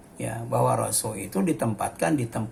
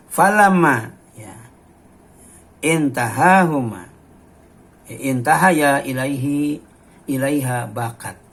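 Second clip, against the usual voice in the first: louder, declaimed Arabic recitation; hadith recitation; 5 dB louder than usual. Acted. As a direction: proclaim the Arabic text to the congregation. (membaca hadis dengan lantang)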